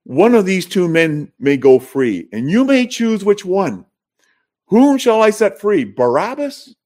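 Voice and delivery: high-pitched, declaiming voice of a governor offering a choice; Pilate addressing the crowd. Retold or imitated. Pilate offers the crowd a prisoner's release, high proclaiming voice. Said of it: "Pilate is saying".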